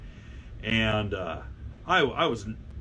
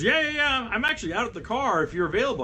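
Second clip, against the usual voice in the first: put-on eager girl; 10 semitones higher than usual. Girl eagerly answers about car.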